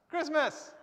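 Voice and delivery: teacher revealing the answer to pupils; excited reveal. Shown as a demonstration. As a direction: announce Christmas like a happy reveal. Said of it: "That's how you'd write Christmas".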